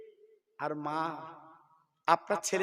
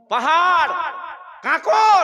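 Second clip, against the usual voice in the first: much higher and louder on each word; preacher stresses the harsh journey; emphatic cry; 9 semitones higher, 12 dB louder, slower than usual. Real, not acted. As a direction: hammer out the hardships. Listing hardships, rising.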